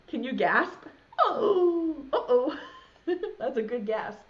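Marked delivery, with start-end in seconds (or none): 1.2-3.4 laughing. Real, not acted. Laughing at child's gasp.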